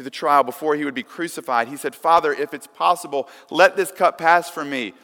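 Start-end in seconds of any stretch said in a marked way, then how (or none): 1.9-4.9 raised, pleading prayer, mildly acted; crying out in prayer. Preacher voicing Jesus praying to his Father, crying out. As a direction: lift pitch and volume a little, imitating an earnest plea. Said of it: "when he cried out to his Father".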